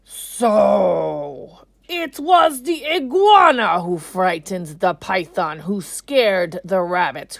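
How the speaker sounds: loud, booming proclamation with drawn-out words; king addressing council. A narrator's reading. King Lion summing up the blame to his council, loud and stately. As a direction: pronounce judgement loudly, stretching the key words. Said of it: "The king said to the council".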